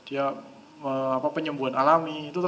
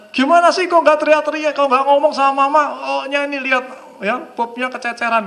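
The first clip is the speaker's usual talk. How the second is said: loud, high-pitched acted telling-off; hammed-up motherly rant at full volume; scolding mother; 10 semitones higher, 9 dB louder than usual. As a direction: scold the child as an exasperated mom. Acts mother scolding her child.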